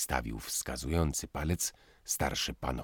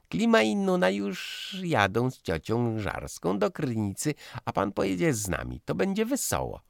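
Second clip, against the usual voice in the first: put-on high, fast, gushing girl's voice; loud chatter; 4 dB louder than usual. Daughter chattering at Józef about the trip, high and fast. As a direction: rattle on eagerly to win him over. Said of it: "panna Rosiczka jest krzykliwa".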